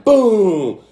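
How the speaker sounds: normal talking voice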